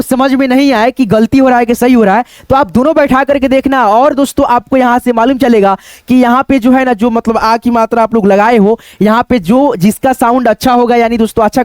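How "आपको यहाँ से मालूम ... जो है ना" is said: ordinary delivery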